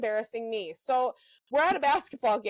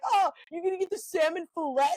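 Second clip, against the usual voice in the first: mock-innocent dad voice, pitched up; teasing dad; 4 semitones higher than usual. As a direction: tease her about the word "fillet", saying it "fil-let". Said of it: "my dad will be like".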